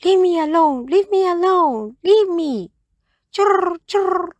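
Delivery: high, loud squeaky bird voice ending in a chirp; a tiny bird scolding and chirping; annoyed little bird, high and chirpy; cross little bird. A narrator's reading. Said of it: "crossly saying".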